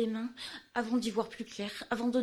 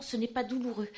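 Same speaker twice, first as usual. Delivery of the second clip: ordinary talk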